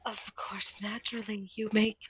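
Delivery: soft unvoiced retort; whisper. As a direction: hiss it back under the breath.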